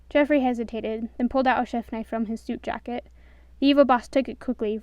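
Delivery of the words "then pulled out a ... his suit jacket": plain reading voice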